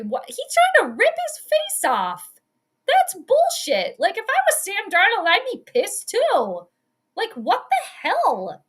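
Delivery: high-pitched, incredulous anger; real outrage. Real, not acted. Fan watching the face mask replay, high outraged protest. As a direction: protest high, dragging out the key words.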